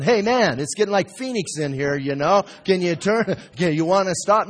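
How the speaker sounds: loud, high-pitched overheated complaint; mock alarmed protest. Pots begging potter to turn heat down, mock alarm.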